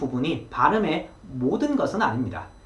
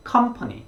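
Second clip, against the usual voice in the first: company with the stress on the first syllable, com, said higher; 8 semitones higher, much slower than usual. Shown as a demonstration. Teacher models company with the first syllable stressed. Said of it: "제일 앞에 음절에 이렇게 강세 표시".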